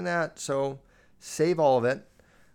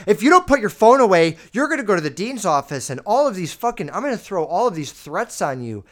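loud, high threats about the dean's office; mock threatening teacher yell; 4 semitones higher, 8 dB louder than usual. Teacher threatening a kid on their phone, loud yelling. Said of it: "when you make it like".

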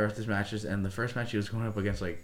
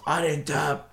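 high, strained retold protest; imitating a fighter's outcry; protesting yell; 6 semitones higher, 4 dB louder, slower than usual. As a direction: yell it as an indignant denial.

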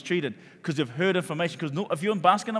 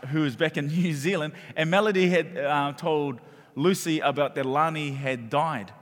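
normal talking voice

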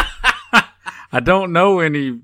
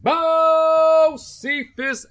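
drawn-out announcer call, much higher; announcer name call; 11 semitones higher, much slower than usual. Acted. Host announcing himself to listeners, slow and high. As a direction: stretch the name like a ring announcer.

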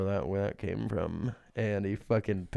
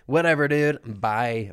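loud, higher dismissive brush-off; mock huffy; 4 semitones higher, 9 dB louder, slower than usual. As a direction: play at storming off, loudly. Mock huff at co-host.

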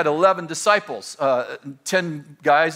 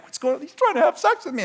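startled outrage; 9 semitones higher, faster than usual. Retold or imitated. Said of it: "I'm like".

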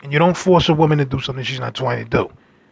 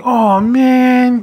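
mock disappointed sigh; 8 semitones higher, 4 dB louder than usual. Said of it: "You know how people do that".

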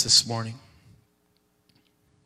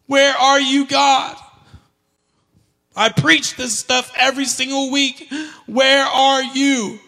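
loud, high pleading cry; crying out to God; 11 semitones higher, 9 dB louder, slower than usual. Retold or imitated. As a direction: demand an answer through hurt. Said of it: "I was crying out to God".

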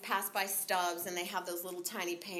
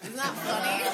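ordinary talk, nothing marked

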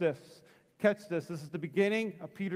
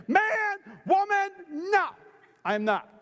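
high, loud comic protest; indignant denial; 13 semitones higher, 6 dB louder, slower than usual. Acted. Peter denies being one of them, loud hammed-up indignation.

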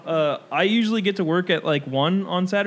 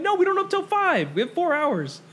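much higher, incredulous retold refusal; exasperated retort; 11 semitones higher than usual. Retelling a refusal to an early customer, exasperated. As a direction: protest in a high exasperated pitch.

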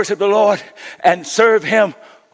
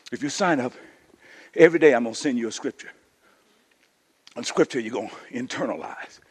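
soft, low aside; 8 semitones lower, 9 dB quieter, faster than usual. Real, not acted. Preacher quietly explaining the fast, soft and low. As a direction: drop to a softer, lower voice.

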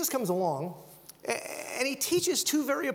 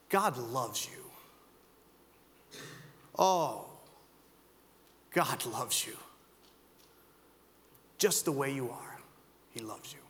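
low, slow, soft and tender; tender whisper-soft; 8 semitones lower, 5 dB quieter, much slower than usual. Acted. Preacher voicing Jesus telling the crowd God loves them, low and soft. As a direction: lean in and say it gently.

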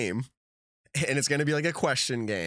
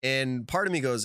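usual speaking voice

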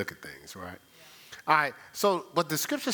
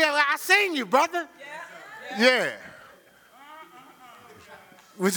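ordinary delivery

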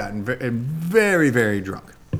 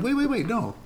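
higher, laughing alarm; sudden realization; 11 semitones higher, 4 dB louder than usual. Retold or imitated. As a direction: jolt awake in disbelief. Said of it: "I'm like".

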